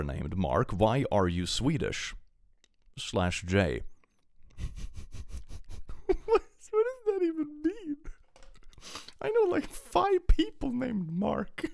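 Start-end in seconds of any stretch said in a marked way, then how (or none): 4.6-11.7 squeaky high, helpless laughter in the words; laughing. Real, not acted. Streamer cracking up at a chat message, laughing as he talks. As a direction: struggle to talk through a laughing fit.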